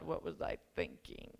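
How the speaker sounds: a dropped-down mock groan of dismay; exasperated inner voice. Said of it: "going like".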